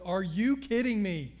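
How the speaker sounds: pastor hits back with loud mock outrage; loud disbelief. Pastor hears secretary never changed oil, disbelieving. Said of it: "I was like".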